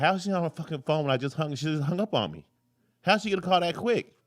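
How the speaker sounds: mock-furious at the bank; retelling a bank phone fight, hammed-up indignant; retold outrage.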